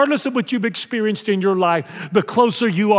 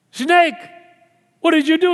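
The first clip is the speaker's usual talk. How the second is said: God questioning; 8 semitones higher, 3 dB louder than usual. Acted. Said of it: "he goes".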